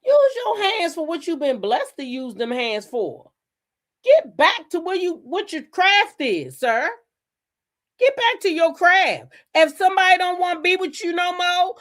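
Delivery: high-pitched, insistent telling-off; scolding rant. Real, not acted. Scolding the absent boxer directly.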